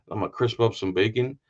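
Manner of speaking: ordinary delivery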